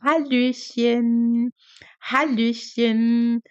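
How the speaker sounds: "Hallöchen" drawn out slowly, every sound clear; slow teacher demo of "Hallöchen, Hallöchen"; slow model pronunciation. Shown as a demonstration. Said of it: "pode falar".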